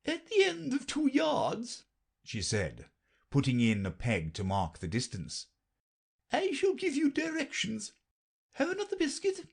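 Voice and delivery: very high brisk queen; matter-of-fact and a little impatient. A narrator's reading. Queen answers Alice, very high and brisk.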